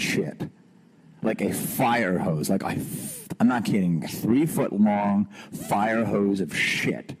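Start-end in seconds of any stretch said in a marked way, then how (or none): none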